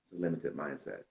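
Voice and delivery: soft, low-volume answer that gets lost; half-heard reply, quiet and falling; quiet first try of "limited mindset"; faint answer. Real, not acted.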